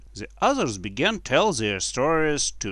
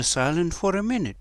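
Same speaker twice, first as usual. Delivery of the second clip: usual speaking voice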